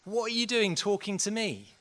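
blunt rebuke. Retold or imitated. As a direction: shake him out of complacency with a shout. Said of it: "He just went".

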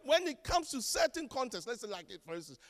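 usual speaking voice

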